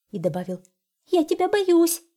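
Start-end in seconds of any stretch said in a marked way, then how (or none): 1.1-2.0 high, loud trembling admission; frightened hare. A narrator's reading. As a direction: confess the fear like a tiny hare. Hare admits its fear.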